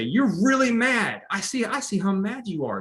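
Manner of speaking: put-on gently mirror the upset kid's anger back to them; validating parent. Shown as a demonstration. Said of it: "I'm gonna kinda do a role play".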